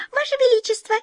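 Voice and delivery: very high tiny mouse voice; high-pitched squeaky little creature; mouse squeak. A narrator's reading. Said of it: "пискнула одна из них".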